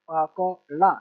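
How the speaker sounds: each syllable set apart. Shown as a demonstration. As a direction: sound it out piece by piece for a learner. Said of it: "छोटा सा letter पढ़ लेना".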